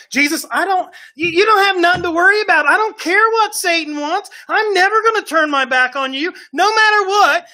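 high, loud, brash promise; cocky boast. Acted. Peter boasting to Jesus he'll never turn away. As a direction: swagger through the promise.

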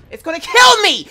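high panicked scream; panicking gamer. Real, not acted. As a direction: shriek it in terror. Panicking in game, being chased.

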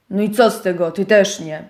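niższy, burkliwy szczur; opryskliwy szczur. Acted. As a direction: burknij nisko. (szczur burczy na owieczkę, niżej)

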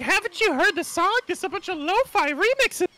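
put-on very high-pitched girlish character voice; put-on Saria voice. Voicing her asking about the song, high-pitched put-on voice. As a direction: squeak it as the game character. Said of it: "She's like".

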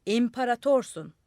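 crowd shout. A narrator's reading. Crowd below answering the madman, shouting. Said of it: "Aşağıdan bağırdılar".

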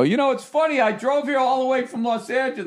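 high, chirpy mock version of himself; mock chirpy self. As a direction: squeak it out like a naive tourist. Telling the cop his trip.

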